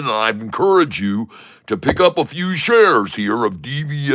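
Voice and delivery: hammed-up formal announcer selling to a crowd; ceremonious, swooping sales pitch; mock showman pitch. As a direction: address the audience like a grand carnival barker.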